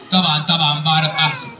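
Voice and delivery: very low puppet character voice; Aragoz puppet. Acted. Puppet telling the host his school subjects, Aragoz voice. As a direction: list it as a puppet, far below your own pitch. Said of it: "الأراجوز ده وهو بيرغي".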